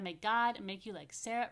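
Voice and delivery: ordinary talk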